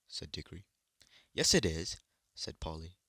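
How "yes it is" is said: loud, insistent yes it is; louder playground retort; childish retort. A narrator's reading. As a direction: insist like a stubborn girl. Children squabbling over names.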